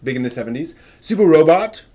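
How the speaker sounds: energetic host kicking off the Great Debate; show intro. Acted. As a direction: announce the segment like a stream host. Show host opens the debate segment.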